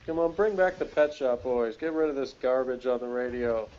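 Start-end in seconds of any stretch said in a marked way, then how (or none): none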